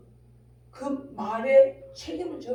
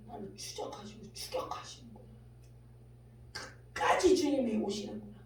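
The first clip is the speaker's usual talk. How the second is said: usual speaking voice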